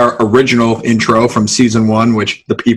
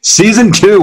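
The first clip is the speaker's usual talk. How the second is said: excited; 10 semitones higher, 3 dB louder than usual. Real, not acted. Excited echo of the opener.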